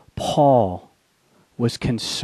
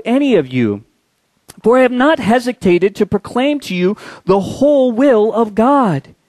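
raised, ringing preacher's voice reading Paul's farewell; fervent scripture reading; 5 semitones higher, 4 dB louder than usual. Acted. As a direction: proclaim it like Paul pleading with the elders.

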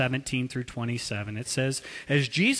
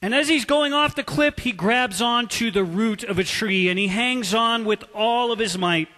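usual speaking voice

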